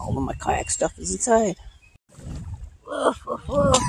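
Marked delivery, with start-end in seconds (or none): 2.9-3.8 higher, yappy pup barks; excited woofs. Pretend. Pup on the river showing off, excited higher barks. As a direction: yap like an eager puppy.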